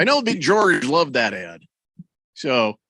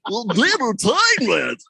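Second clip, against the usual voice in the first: slow, hammed-up, pitched-up ad voice; slow, pitched-up mock of an overblown radio read; mock puke read; 6 semitones higher, 3 dB louder, slower than usual. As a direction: overdo it like a cheesy announcer. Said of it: "I didn't say".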